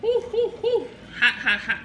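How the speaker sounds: panting through hot noodles; talking around a scalding bite; mouth full of hot food. Real, not acted. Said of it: "See all that steam coming through".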